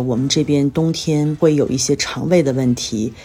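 normal talking voice

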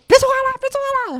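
mock the helpless teacher's squeak, twice over; shrill teacher. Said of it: "老师说".

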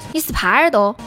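normal talking voice

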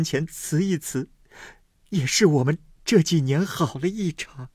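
tearful plea. A narrator's reading. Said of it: "思琪因又哭告道".

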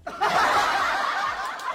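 laugh. Real, not acted. Laughs at own story.

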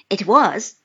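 normal talking voice

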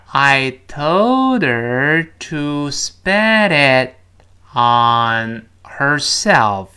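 very slow, drawn-out teacher demo; slowed-down model reading. Shown as a demonstration. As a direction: stretch every word out for learners. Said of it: "我们放慢一点". (放慢示范朗读，拖长每个词)

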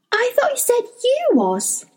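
put-on a girl confused by what he said; puzzled young girl. A narrator's reading. Lyra questions old man.

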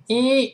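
"一" held as a long ee; sustained ee demo on "一"; long vowel. Shown as a demonstration. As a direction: stretch "一" out. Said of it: "长音发一这个声音".

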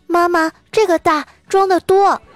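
put-on high, slow little child voice; baby voice. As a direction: coo it like a toddler sucking up. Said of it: "奶声奶气地说".

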